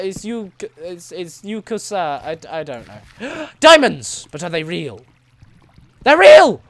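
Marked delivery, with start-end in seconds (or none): 3.5-4.3 gasp, then a loud, excited cry; thrilled to finally spot diamonds; loud, excited shout. Real, not acted. Finds diamonds. 6.0-6.6 much higher, much louder cry of joy; real joy, shouted high; thrilled shout. Real, not acted. Diamonds turn out real.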